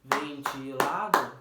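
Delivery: "Ventilado" stretched slow and loud, leaning on "la"; syllables beaten out. Shown as a demonstration. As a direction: clap out "Ventilado" with the weight on "la". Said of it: "lá é a sílaba tônica". (Ventilado devagar, com força no "la")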